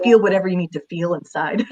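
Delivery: ordinary talk, nothing marked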